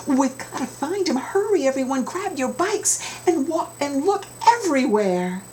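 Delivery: put-on rally the other kids to hurry; urgent rally. Kid urging friends to search, high and urgent.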